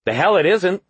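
high, loud angry retort; snapping. A narrator's reading. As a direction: snap back at her. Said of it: "the one in front snapped".

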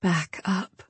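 soft, coaxing back up; gentle. Acted. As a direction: ease him back softly. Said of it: "she said gently".

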